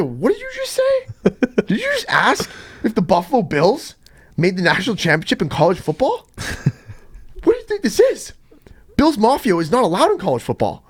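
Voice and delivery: high-pitched, disbelieving outburst; incredulous reaction. Retold or imitated. Retelling the reaction to his teammate's question, incredulous. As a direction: squeak it out in mock outrage at a clueless question. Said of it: "we said".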